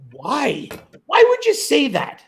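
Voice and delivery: high-pitched mock disbelief on the question; incredulous reply. Host replays his joke about a rude comment, incredulous. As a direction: act out baffled disbelief, voice leaping up.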